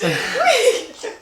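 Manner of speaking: high laughing whee; playful whee. Real, not acted. Mother pushing her toddler on the swings.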